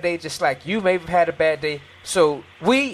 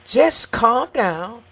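urging calm; 6 semitones higher, 3 dB louder, much slower than usual. Real, not acted. Urging calm with drawn-out words.

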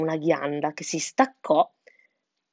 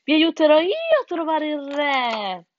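scheming fox; 9 semitones higher, 4 dB louder than usual. A narrator's reading. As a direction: lure them along with showy helpfulness. Fox lures the birds. (volpe che invita con voce alta e suadente)

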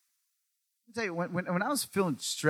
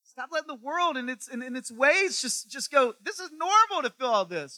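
pleading Jesus; 10 semitones higher, 4 dB louder than usual. Retold or imitated. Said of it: "'cause he's like".

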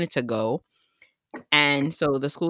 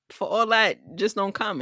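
exasperated; 6 semitones higher than usual. Retold or imitated. Retold own exasperated reply.

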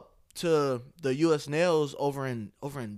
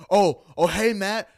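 higher-pitched imitation of a worker confronting his manager; retold employee confrontation; 7 semitones higher, 5 dB louder than usual. Worker confronts manager over a beating, retold in a raised voice. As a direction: open louder, keep the mock complaint up high.

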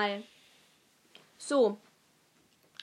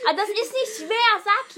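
loud, raised impatient demand; real impatient outburst; exasperated urging; 8 semitones higher, 8 dB louder than usual. Real, not acted. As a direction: push them to just say it, higher and louder. Impatient urging to pronounce name.

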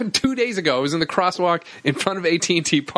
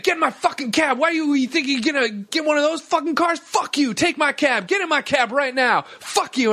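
high-pitched ranting cab driver; retold angry yelling; 9 semitones higher than usual. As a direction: rant at a would-be passenger, imitating an irate cabbie. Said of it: "after you're yelling at me".

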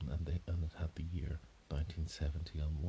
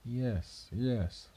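higher, puzzled yes yes; unsure what to make of her; pitched-up bewildered agreement; 6 semitones higher, 4 dB louder than usual. Acted. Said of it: "curiously wondering".

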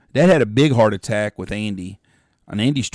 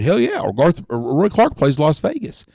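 mock indignant dad; 6 semitones higher, 4 dB louder than usual. Dad arguing back.

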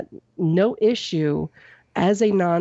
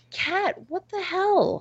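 high, slow exasperated pet voice; drawn-out high "what the hell" at the pet; exasperated at the cat; 12 semitones higher, slower than usual. Real, not acted. To the cat climbing around mid-podcast, high slow exasperation.

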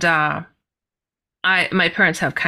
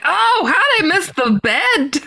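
surprised; 10 semitones higher, 3 dB louder than usual. Retold or imitated. Waking up on the floor.

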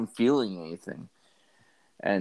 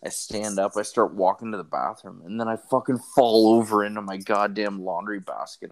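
usual speaking voice

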